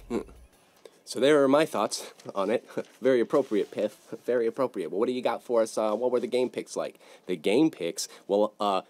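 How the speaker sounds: ordinary delivery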